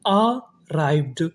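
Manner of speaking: Arrived split slowly as अ, राइव्ड; syllable-by-syllable. Shown as a demonstration. Teacher building arrived for students, slow and split. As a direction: pause after the first vowel of arrived. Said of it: "तो meaning क्या बनी".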